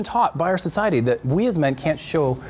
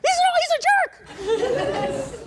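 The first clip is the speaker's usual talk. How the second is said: high, squeaky hammed-up girlfriend venting; very high, slow mock rant, laughing through it; mock angry girlfriend; much slower than usual. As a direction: play a worked-up friend egging her on, way up high. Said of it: "She gets even more angry".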